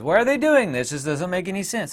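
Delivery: confused whine. Retold or imitated. Quoting confused people.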